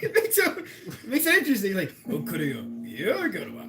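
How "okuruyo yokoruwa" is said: playful fake Japanese phrases; hint of an anime voice; lightly voiced anime line; mock anime Japanese. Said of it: "with a bit of a voice".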